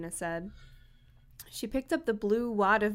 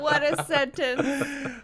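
slow, higher remark amid laughter; amused disbelief; 5 semitones higher, much slower than usual. Real, not acted. Friend reacts to the absurd sentence, laughing. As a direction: stretch it out while laughing.

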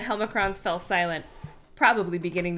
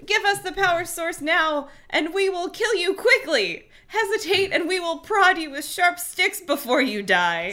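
a bit higher, pompous threat; lightly voiced tiny alien; 9 semitones higher, 3 dB louder than usual. A narrator's reading. Helmicrons threatening the kids, lightly high alien voice. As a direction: menace like a mouse-sized tyrant.

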